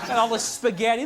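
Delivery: usual speaking voice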